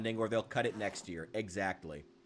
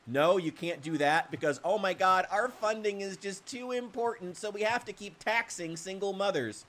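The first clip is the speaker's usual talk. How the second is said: higher whiny bureaucrat voice, pitched up; mock bureaucrat; 8 semitones higher than usual. Parody bureaucrat answering a citizen, whiny and high. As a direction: sneer it like a prissy official.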